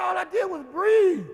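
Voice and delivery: high, loud protest of innocence; retold protest. Son pleading innocence to his scolding father, loud and high. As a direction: blurt it out defensively. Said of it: "I said".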